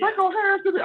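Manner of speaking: whiny falsetto impression of a complaining fan; mocking Reggie. Teasing a Colts fan with a whiny squeal. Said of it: "He's gonna say".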